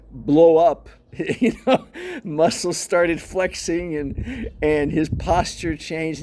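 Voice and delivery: laughing. Real, not acted. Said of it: "I'm laughing 'cause".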